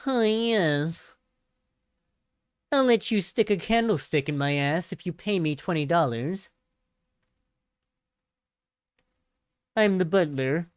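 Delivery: a hint of a prim, obsequious butler; Zan Butler voice. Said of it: "that's my Zan Butler voice".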